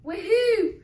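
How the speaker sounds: loud, high whoop; triumphant cheer. Acted. Cheer as wolf is thrown away.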